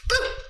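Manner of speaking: sudden loud boo to scare; startling boo. Acted. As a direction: jump out and yell boo. Halloween jump scare in video.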